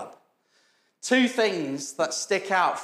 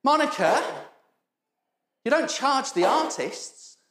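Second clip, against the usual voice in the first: calling out; 7 semitones higher than usual. Retold or imitated. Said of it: "He goes".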